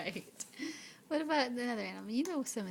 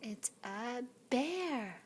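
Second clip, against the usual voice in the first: slow and clear; much slower than usual. Shown as a demonstration. Slow teaching.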